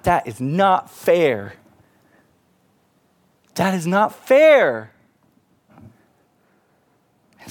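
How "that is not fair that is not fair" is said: indignant protest. Acted. Students' indignant protest, repeated.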